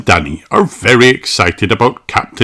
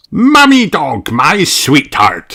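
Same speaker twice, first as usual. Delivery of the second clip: louder, slow fond greeting; affectionate captain; 7 semitones higher, 5 dB louder than usual. A narrator's reading. Captain Dog greeting his wife, lightly voiced fond captain. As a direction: greet your wife lovingly. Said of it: "says Captain Dog".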